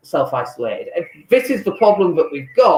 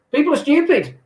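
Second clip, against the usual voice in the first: defiant retort; 10 semitones higher than usual. Real, not acted. Defiant rebuttal.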